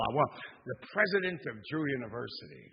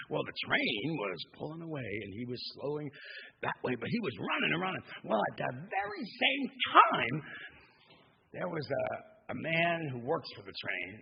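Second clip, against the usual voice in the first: normal talking voice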